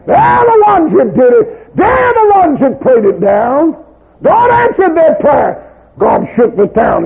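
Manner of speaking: slow, high, loud shouting preacher; full-force revival preacher's shout; fiery preaching climax. Real, not acted. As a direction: hammer it home to the crowd.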